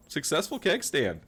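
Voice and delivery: usual speaking voice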